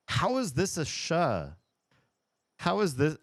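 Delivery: high, incredulous question at the spelling; can't believe the spelling makes that sound; baffled disbelief. Real, not acted. Reading the word's spelling to chat, high incredulous disbelief.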